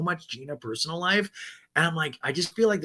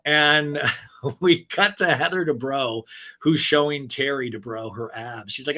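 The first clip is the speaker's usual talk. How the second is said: normal talking voice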